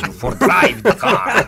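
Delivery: laughing, slow Russian-accented line. Shown as a demonstration. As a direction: play the Russian car lover, slow and amused. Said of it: "When I'm doing a Russian".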